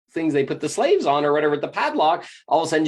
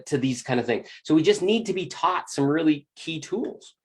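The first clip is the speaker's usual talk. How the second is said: ordinary talk, nothing marked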